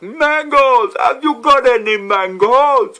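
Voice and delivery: very high, very loud, slow mock shopper yell; mock hawker shout. Mocking a customer shouting for mangoes, loud hawker yell. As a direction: bellow it like a silly customer. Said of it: "They're not just standing at the fruit store like".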